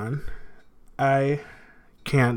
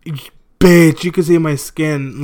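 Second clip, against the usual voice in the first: pained outburst; 3 semitones higher, 9 dB louder, slower than usual. Real, not acted. Ripping off mask, in pain.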